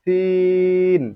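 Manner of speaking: সিন with a long held ee; সিন drawn out for three beats; elongated vowel. Shown as a demonstration. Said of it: "সিনটা তিন আলিফ পরিমাণ টানতে হবে".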